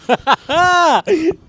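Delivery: high rising real laugh; high burst of laughter. Real, not acted. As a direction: crack up at the insult. Laughing at teacher's comment.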